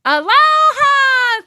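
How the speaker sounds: high, slow, loud call; loud aloha. Real, not acted. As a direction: call it out long. Outro greeting.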